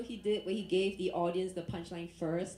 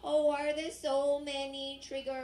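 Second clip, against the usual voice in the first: liberal snowflake; 7 semitones higher than usual. Retold or imitated. Liberal snowflake panics on the battlefield, high whiny mock voice. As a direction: wail it like a fragile snowflake at war. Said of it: "imagine liberal snowflakes at war".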